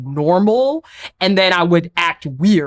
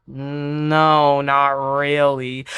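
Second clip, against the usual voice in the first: slow, stretched-out hillbilly drawl; lower, drawling hillbilly imitation; imitating hillbilly drawl; 4 semitones lower, much slower than usual. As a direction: drag each word out in a low country drawl. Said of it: "starts to mock the way she talks".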